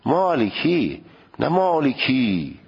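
مالکی drawn out slowly with a light final i; careful demo. Shown as a demonstration.

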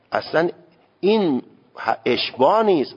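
ordinary talk

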